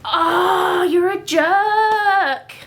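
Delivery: high, loud, drawn-out cry; exasperated shout. Real, not acted. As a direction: yell at the naughty cat. Cat interrupts the reading.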